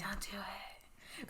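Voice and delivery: slow, very soft whisper; drawn-out whisper. Pretend. Co-host urging editor to keep it, slow whisper. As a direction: whisper it slowly like a little voice on the shoulder.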